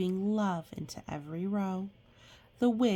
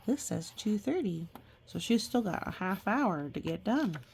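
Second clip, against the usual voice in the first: ordinary delivery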